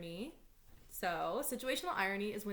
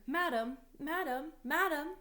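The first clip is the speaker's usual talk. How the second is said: high, raised repeated call; calling to wake; 7 semitones higher, 5 dB louder than usual. Acted. Nurse must wake Juliet, calling out to her. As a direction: call out to rouse a sleeper.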